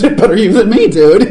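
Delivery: loud, high, amused jibe on the edge of laughter; imitated laughing tease. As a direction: mimic friends laughing at your plight. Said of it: "looks at me and laughs and says".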